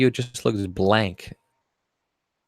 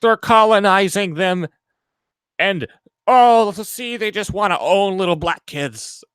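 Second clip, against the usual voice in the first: hammed-up indignant accusation, pitched higher; mock accusing; 8 semitones higher than usual. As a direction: parody a self-righteous critic. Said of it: "they're gonna be like".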